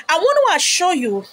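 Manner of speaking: ordinary talk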